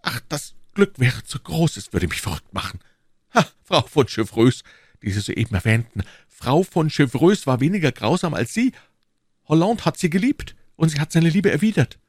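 impassioned pleading duke, lightly voiced; ardent suitor. Duke reproaches the queen he loves, ardent pleading. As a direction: press the case with lively rises.

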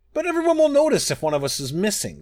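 high, rushed protest; crying out. A narrator's reading. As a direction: cry out in alarm as the threatened moon. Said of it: "cried Master".

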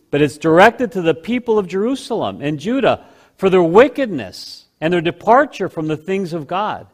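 ordinary delivery

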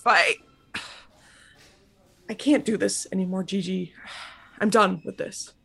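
soft, low, giving up; defeated. Acted. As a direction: sigh and give up quietly.

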